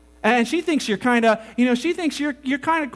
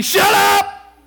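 loud, high shout of shut up; shouted rebuke; 10 semitones higher, 8 dB louder than usual. Acted. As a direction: bark it out to silence the temptation. Preacher acts out rebuking temptation.

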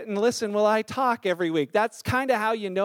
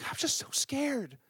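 scared confession; 3 semitones higher, 9 dB quieter, slower than usual. Retold or imitated. Scared teen confesses to parents.

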